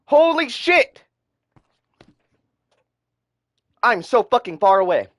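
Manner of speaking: high, loud exasperated shout; raging gamer. Real, not acted. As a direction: yell in frustration.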